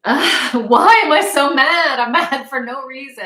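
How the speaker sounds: loud, high, half-laughing angry wail; venting bewildered anger; mad outburst. Retold or imitated. After throwing keys at the wall, a loud bewildered outburst. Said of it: "I'm like".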